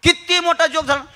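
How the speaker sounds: ordinary talk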